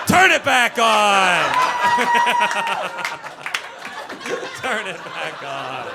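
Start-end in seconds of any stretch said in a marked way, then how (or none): 0.1-1.4 loud, slow, higher shout. Real, not acted. Yelling at the crowd to keep laughing, loud and slow. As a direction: demand it loudly and slowly. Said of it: "crowd went quiet".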